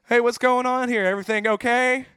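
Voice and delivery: imitated officer hailing a group; cop calling out. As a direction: call out from the car window to check on them. Cop calls from car window.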